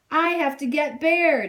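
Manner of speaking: put-on boy pleading loudly; desperate yell. A narrator's reading. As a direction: shout for your lost toy. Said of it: "Jack yelled".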